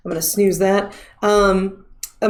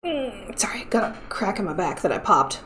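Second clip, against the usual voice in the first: hushed whispered apology; whispering; 8 dB quieter than usual. Real, not acted. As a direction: whisper it offhand. Quiet apology while popping back.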